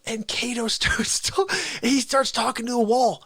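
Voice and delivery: usual speaking voice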